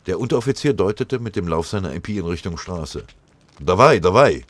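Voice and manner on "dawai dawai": loud Russian command; forceful soldier's shout; barked order. A narrator's reading. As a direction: order the prisoners to move, loudly. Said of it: "befahl er".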